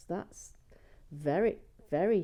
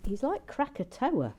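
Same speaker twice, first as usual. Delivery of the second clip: ordinary talk, nothing marked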